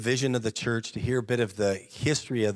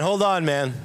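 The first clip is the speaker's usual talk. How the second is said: loud, higher protest; mock alarm; 5 semitones higher, 5 dB louder than usual. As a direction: hold it up, playful and loud. Reacting to wife's suggestion.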